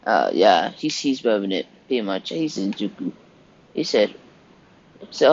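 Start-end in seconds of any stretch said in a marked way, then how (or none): none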